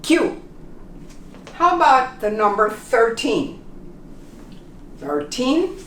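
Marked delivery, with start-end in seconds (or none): 0.0-0.4 Q said kyoo, lifted; Q demo. Shown as a demonstration. Teacher repeats Q for students, kyoo. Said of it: "K I U". 5.0-5.8 Thirteen with th at the front; thirteen demo. Shown as a demonstration. Teacher models Thirteen for learners. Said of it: "the T H here in the front".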